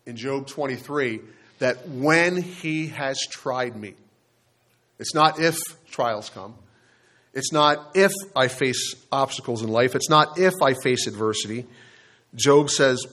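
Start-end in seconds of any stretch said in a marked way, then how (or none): 1.9-3.9 "when" drawn out, the phrase slow and deliberate; slow right down and lean on "when"; stress on WHEN. Real, not acted. Preacher contrasts when with if. 5.0-11.7 "if" punched out in each repetition; stress on IF. Real, not acted. Preacher contrasts if with when.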